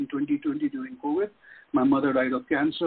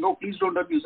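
high, mocking imitation of the scammers; mock scammer plea. Mocking the scammers begging him to stop, high mock plea. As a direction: mimic their whiny plea, pitched far up. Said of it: "they're saying".

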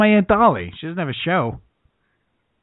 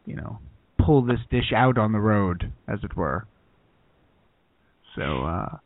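low, timid, bland TV cook; hesitant host backing away from boldness; Chef Neutral; 6 semitones lower than usual. Acted. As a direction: keep it low, halting and noncommittal. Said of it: "everything Chef Neutral makes is, like, very bland".

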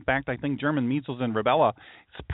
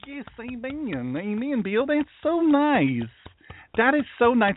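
high, drawn-out mock praise; mock gushing; 7 semitones higher than usual. Host sarcastically praising Bill's banking news, in mock gushing. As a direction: gush in a high put-on voice, dragging the words.

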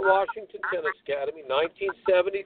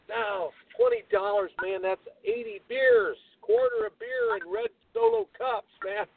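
excited lament; 11 semitones higher than usual. Real, not acted. Lamenting the lost beer money.

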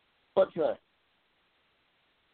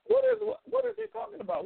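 incredulous. Real, not acted. Baffled by a chat message.

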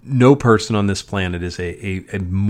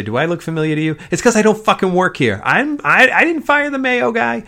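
higher, louder ranting comic impression; mock outraged comedian getting louder; Bill Burr impression; 12 semitones higher, 4 dB louder than usual. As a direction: rant it like an exasperated stand-up. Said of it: "I can imitate Bill Burr".

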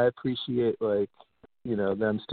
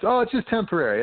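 higher, sing-song dismissive oh; mocking what authorities kept saying, higher sing-song; mocking parody; 8 semitones higher than usual. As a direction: parody the officials' reassurance. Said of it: "I remember them saying".

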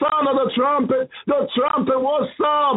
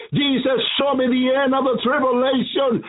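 ordinary talk

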